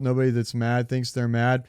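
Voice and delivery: usual speaking voice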